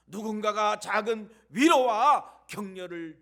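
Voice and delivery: 누군가가 작은 위로와 격려를 주는 burst out loud, 위로와 hit hardest; loud preaching emphasis. Shown as a demonstration. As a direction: call it out with full force, peak on 위로와. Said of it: "repeated for emphasis". (위로와를 크게 힘주어 외치기)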